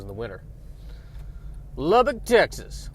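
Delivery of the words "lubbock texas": high, loud, drawn-out Texas drawl; Southern Texas accent, slow and high. Pretend. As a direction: call it out like a proud Texan. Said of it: "a Southern Texas accent today".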